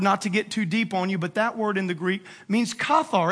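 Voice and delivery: ordinary talk, nothing marked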